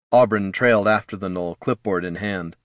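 ordinary delivery, nothing marked

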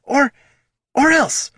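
high, loud faltering threat; threaten, but lose the words; stumbling threat. Acted.

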